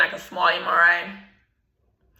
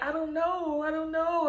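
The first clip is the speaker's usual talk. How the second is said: teary mock bewilderment, higher and softer; 11 semitones higher, 5 dB quieter than usual. She was crying in church.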